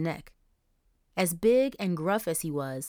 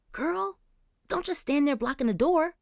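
put-on high girl voice; 9 semitones higher than usual. Charisse pushing Angel forward.

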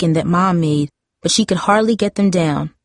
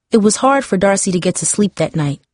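ordinary delivery, nothing marked

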